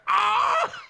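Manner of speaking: high, loud yelp; pained cry. Acted. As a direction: yelp as the kick hurts. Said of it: "he's like".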